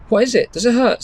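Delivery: mother anxiously asks her daughter about her eye; worried mum. Acted. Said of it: "said Mum".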